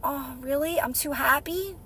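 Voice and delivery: small, stung reply; hurt and deflated. Retold or imitated. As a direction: let the words sink, taken aback. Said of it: "And I go".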